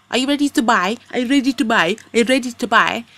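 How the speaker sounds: imitating a pushy seller; badgering shopkeeper. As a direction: pester the customer in a mock shopkeeper voice. Mimicking shopkeepers badgering her.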